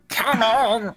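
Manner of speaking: high squeaky duck cry; duck orgasm noise. Retold or imitated. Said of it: "I assume it would sound like".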